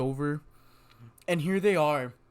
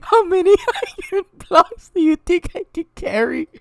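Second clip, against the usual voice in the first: put-on very high, loud child squeal; squeaky kid voice; 11 dB louder than usual. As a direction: shriek it like an excited little kid. Friend repeats kid's line.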